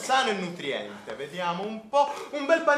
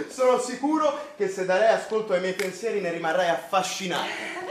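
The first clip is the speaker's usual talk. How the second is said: ordinary talk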